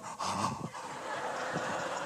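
long silent gasp of wonder; awed gasp. Retold or imitated. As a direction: gasp in awe at the falls. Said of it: "Helen's going".

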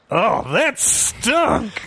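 slow, high mocking cartoon kid; Nelson voice. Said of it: "to quote Nelson".

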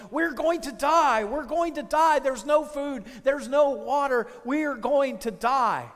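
loud, high panicked cries; castaway yelling in terror at his friend. Retold or imitated. Shipwrecked man yelling to his companion, panicked and loud. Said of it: "started screaming and yelling".

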